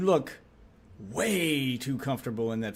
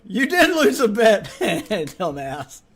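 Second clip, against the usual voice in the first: louder, higher, slow mocking laugh; laughing jab; 7 semitones higher, 7 dB louder, much slower than usual. Real, not acted. Streamer teasing the creator for losing a bet, laughing. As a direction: tease him while laughing.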